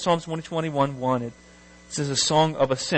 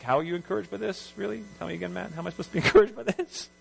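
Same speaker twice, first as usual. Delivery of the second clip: higher, softer incredulous questioning; baffled aside in a raised, hushed pitch; mock disbelief; 4 semitones higher, 4 dB quieter, much faster than usual. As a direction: question it quickly, half laughing. Acting out his own incredulous reaction.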